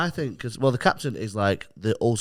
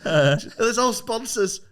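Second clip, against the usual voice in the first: laughing; 9 semitones higher than usual. Real, not acted. Laughing through the story.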